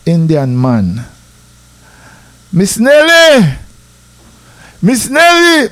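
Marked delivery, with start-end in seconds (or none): 2.5-5.7 high, drawn-out call to a neighbour; loud, high-pitched holler with stretched vowels. Retold or imitated. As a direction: imitate a man hollering for the woman of the house. Man calls out to the grandmother.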